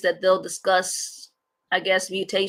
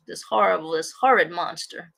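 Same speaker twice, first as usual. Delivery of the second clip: ordinary talk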